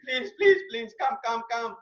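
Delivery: high-pitched hammed-up begging; mock pleading. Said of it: "And now you're saying".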